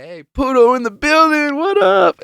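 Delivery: high, loud imitation of a hollering friend; retold crowd-rousing shout; hyped call-out. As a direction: holler it like a hype man spotting someone.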